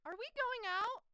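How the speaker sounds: high, loud blurted question; nervous blurt. Retold or imitated. Heroine finally finding courage to ask him, blurting. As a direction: blurt it out, pitched right up.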